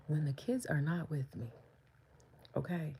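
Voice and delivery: unvoiced whisper; hushed confiding whisper; conspiratorial whisper. Real, not acted. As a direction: let them in on a secret. Confiding aside.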